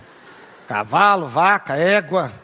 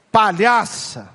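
insult; 5 semitones higher, much slower than usual. Retold or imitated. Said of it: "a dar um tom acima".

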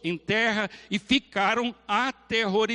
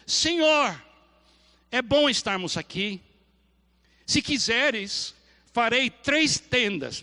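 eager Peter; 4 semitones higher, 3 dB louder than usual. Acted. Peter voiced speaking to Jesus.

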